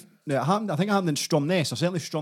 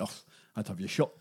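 deadpan threat; 7 semitones lower, 10 dB quieter than usual. Retold or imitated. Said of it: "She went".

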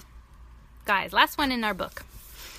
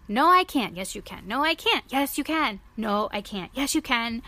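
playful back-and-forth argument; 4 semitones higher, 6 dB louder than usual. Acted. Gerald and Piggy argue back and forth.

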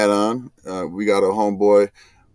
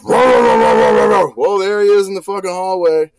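high, loud startled call; sudden shout at spotting the dog; excited shout; 9 semitones higher, 5 dB louder, slower than usual. Retold or imitated.

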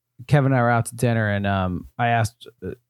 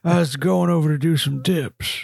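[name] impression; 2 semitones higher than usual. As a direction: make a mock excuse in the singer's voice. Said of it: "it'd just be him".

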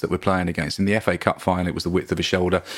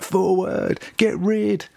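much higher, mock fan yell for the long ball; old-school fan; 12 semitones higher, much slower than usual. Fans in the stands calling for long balls, a mock shout. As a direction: imitate the old fans barking orders. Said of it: "fans there that are like".

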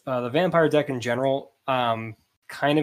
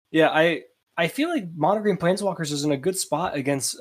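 ordinary delivery

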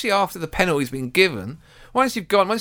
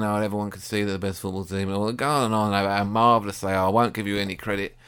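low grudging manager, lightly voiced; a hint of a grumbling manager, pitched down; Dalglish; 7 semitones lower, 4 dB quieter than usual. Football manager refusing to give credit, low grudging mock voice. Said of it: "Dalglish afterwards was going".

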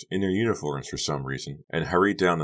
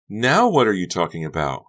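raised, loud, baffled question; bewildered; 4 semitones higher, 6 dB louder than usual. A narrator's reading. Honey to Becca's vague accusation, bewildered. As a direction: ask as if completely lost. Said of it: "Honey asked, bewildered".

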